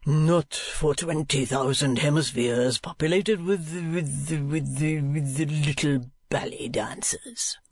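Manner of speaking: slow, languishing poetic flourish; poetical strain. A narrator's reading. Husband flattering his wife, slow poetical strain. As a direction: draw it out like mock verse. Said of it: "in a poetical strain".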